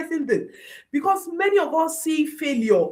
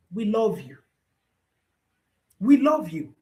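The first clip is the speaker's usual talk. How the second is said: usual speaking voice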